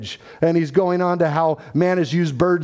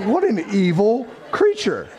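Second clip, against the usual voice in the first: higher, lightly hammed-up outrage; mock indignation; 5 semitones higher than usual. Bitten customer reacts to laughing cockatoo, mock indignation. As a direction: exclaim at the bird in mock horror.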